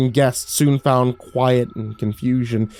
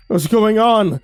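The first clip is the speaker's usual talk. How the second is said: high, raised frightened voice; panicked question, pitched way up; helpless cry; 10 semitones higher, 4 dB louder, slower than usual. A narrator's reading. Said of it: "voice was a helpless drone".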